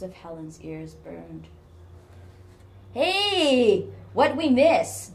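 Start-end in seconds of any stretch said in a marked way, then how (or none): 2.9-5.1 high, loud, jovial call; joshing. A narrator's reading. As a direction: call out teasingly as you approach. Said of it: "Darren joshed".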